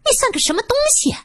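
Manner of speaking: 高声尖利、越说越快的怒斥; 轻蔑地顶回去，音调拔得很高. A narrator's reading. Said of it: "殷玉珍大怒". (殷玉珍怒斥劝架的陈潇潇，尖声又快)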